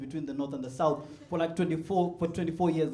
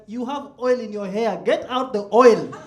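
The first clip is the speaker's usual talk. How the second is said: slow, louder laughing order; telling sister the kids want the oil; mock command; 4 semitones higher, 9 dB louder, slower than usual. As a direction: boss the girls to dig out the oil. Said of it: "I'm like".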